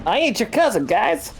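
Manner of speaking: high, put-on voice, fed up and protesting; shrill denial, sick of repeating himself; exasperated Igor. Igor protests he's no fleaman.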